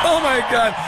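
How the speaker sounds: host overcome by the clip; thrilled exclamation. Real, not acted. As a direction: exclaim in delight.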